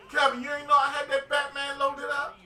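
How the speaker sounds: loud, high teasing call; excited shout. Real, not acted. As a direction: shout it out gleefully. Teasing friend, playing a song.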